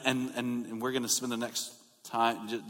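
ordinary talk, nothing marked

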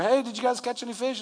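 high, fast mock yell; calling from shore. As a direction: imitate a call across the water. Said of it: "Jesus yells out from the shore".

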